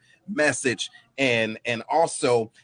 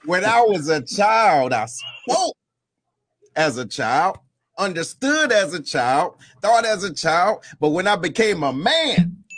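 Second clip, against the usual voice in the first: rising impassioned oratory, building louder; preacher's voice; 7 semitones higher, 3 dB louder than usual. Pretend. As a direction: preach it like a Sunday sermon, building up. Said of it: "put on my, my preacher's voice".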